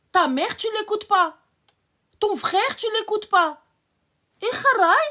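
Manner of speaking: loud, high-pitched outrage; indignant scolding. Real, not acted. Scolding women who ignore mother and brother, indignant. Scolding listeners for obeying men.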